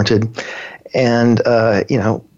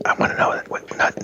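whispered puzzlement; whispering; 6 dB quieter than usual. Pretend. Host questioning co-host on whispering, whispered.

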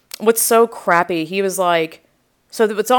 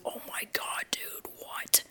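whispered disbelief; stunned, voiceless aside; aghast whisper; 18 dB quieter than usual. Retold or imitated. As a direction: whisper it under your breath, appalled. Inner aghast reaction, whispered.

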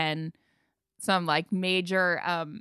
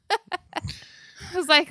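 laughing; 9 semitones higher, much slower than usual. Real, not acted.

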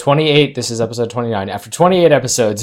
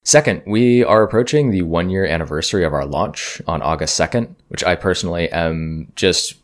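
podcast promo read; 3 semitones lower than usual. Acted. Host reads intermission promo.

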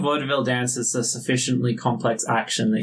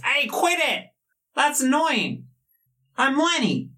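high, indignant little whine; a much higher whiny dagger voice; squeaky dagger voice; 12 semitones higher than usual. Acted. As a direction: protest like an offended tiny creature. Talking dagger Lenny complains.

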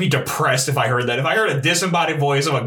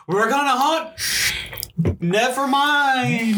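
high squeaky ghost voice, much higher; 9 semitones higher, much slower than usual. Pretend. Ghost meeting them, squeaky and high. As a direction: play a timid ghost giving up.

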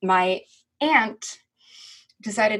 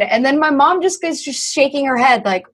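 usual speaking voice

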